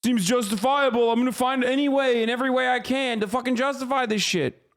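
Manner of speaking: sneering impression of someone shrugging it off; dumb apologist voice. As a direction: play the clueless defender, mocking him. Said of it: "you're like".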